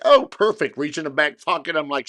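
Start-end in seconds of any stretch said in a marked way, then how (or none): 0.1-0.8 a burst of eager delight, higher and louder; like you've been waiting for this exact moment; excited. Retold or imitated. Retelling handing the band stickers, eager and bright.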